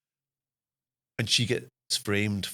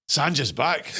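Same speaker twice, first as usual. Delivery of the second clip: high, loud triumphant announcement; a gleeful self-announcement; triumphant return; 6 semitones higher, 4 dB louder, slower than usual. Retold or imitated. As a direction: announce your comeback with glee. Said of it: "and I'm like".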